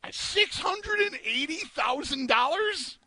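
high, slow, incredulous; shocked disbelief. Real, not acted. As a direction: stretch it out in disbelief. Reacting to huge payout.

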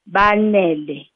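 Banele drawn out in three syllables; syllable demonstration. Shown as a demonstration. Teacher counting syllables with hand under chin, Banele sounded out deliberately. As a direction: sound out Banele slowly, one chin movement per syllable. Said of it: "chin is gonna move together with my hand three times".

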